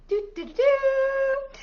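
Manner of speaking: high, held "doo-doo-doo" trumpet flourish; playful fanfare. Pretend. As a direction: toot a slow, high little fanfare. Playful fanfare presenting book.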